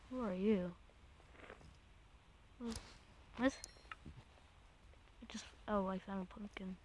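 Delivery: soft hushed whisper. Real, not acted. Player sneaking through the game, hushed whisper. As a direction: hush it, afraid to be heard.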